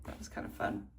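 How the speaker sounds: soft whisper, confiding; whispered aside. Real, not acted. Vlogger whispering to viewers about the race ornament. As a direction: drop to a whisper as if someone's sleeping.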